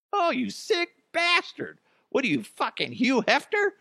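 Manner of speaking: high, shrieking mock disgust; mock outraged spouse. Spouse hears the monkey excuse, high mock outrage. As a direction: play the appalled spouse, pitch way up. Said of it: "tell your spouse".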